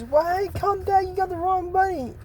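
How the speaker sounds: pitched far up, small and fussy; high squeaky bunny. Retold or imitated. Bunny telling Sophia she has the wrong bunny, high and squeaky. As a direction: squeak it like a panicked little rabbit.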